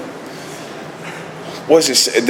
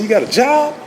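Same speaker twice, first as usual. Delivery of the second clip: high, playful question with a smile; smiling God; 13 semitones higher than usual. Retold or imitated. God upping the price, smiling and playful. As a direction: lift it up high, sweetly asking for more. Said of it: "God smiles again".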